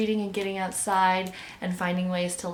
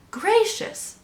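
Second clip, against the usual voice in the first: high-pitched burst of surprise; exclaiming; 13 semitones higher than usual. A narrator's reading. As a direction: exclaim in astonishment, voice leaping up. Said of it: "Jubal exclaimed".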